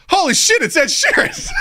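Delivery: mock starstruck squeal; stunned delight. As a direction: gasp it out like a starstruck fan. Audience seeing [name].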